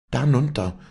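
high, forceful burst; thundered. A narrator's reading. Walli thunders the terrible word at Vinzenz, forcefully. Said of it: "das sie ihm ins Ohr donnerte".

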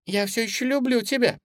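put-on high, quick child's voice; high-pitched childish affection; little girl. A narrator's reading. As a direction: reassure sweetly as a small girl. Suzy soothes the bunny.

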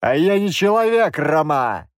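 loud, high angry cry; angry outburst. Acted. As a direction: throw it at him as a threat. (громкий злой выкрик)

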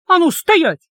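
high, loud barked command. A narrator's reading. Heroine ordering the guard to stop, barked command. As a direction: bark the order. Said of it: "рявкнула я".

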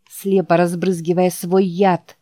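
ordinary delivery, nothing marked

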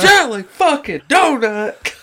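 high, drawn-out mock outrage; movie drill sergeant. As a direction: punch each word slowly like an appalled sergeant. Quoting Full Metal Jacket drill sergeant.

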